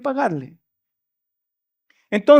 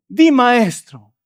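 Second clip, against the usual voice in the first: slow, drawn-out, falsely respectful "Di, maestro"; oily, insincere "Di, maestro", said slowly; mock hypocritical deference; much slower than usual. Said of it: "denota aún más la hipocresía".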